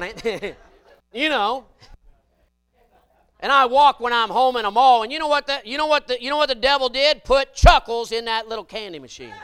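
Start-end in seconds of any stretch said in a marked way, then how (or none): 3.4-9.3 loud, high preacher's holler; full-force preaching. Acted. Preacher warning the congregation about temptation, hollering. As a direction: drive it home to the pews.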